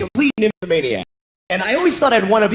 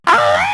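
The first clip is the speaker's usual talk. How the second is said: sudden high loud climax yelp; a startling high-pitched ah; 4 dB louder than usual. Pretend. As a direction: blurt out a shocking high cry. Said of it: "you go".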